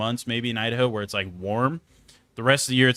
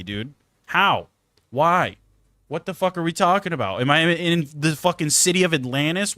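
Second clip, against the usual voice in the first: exasperated rant; 6 semitones higher than usual. Retold or imitated. Ranting about humidity.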